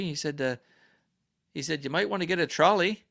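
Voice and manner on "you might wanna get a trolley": higher-pitched hint of a British accent; lightly imitated British accent. British employee suggests a trolley to a customer, light imitated British accent. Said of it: "British accent".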